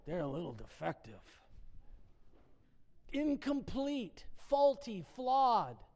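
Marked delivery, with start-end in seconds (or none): 0.0-1.4 judgmental aside. Acted. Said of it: "point fingers at others and say".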